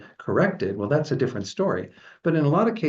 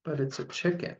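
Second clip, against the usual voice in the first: small, soft, higher-pitched tearful plea; almost in tears; 5 semitones higher, 6 dB quieter than usual. A narrator's reading. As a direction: plead softly as if about to cry. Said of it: "was almost in tears".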